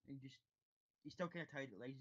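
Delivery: ordinary delivery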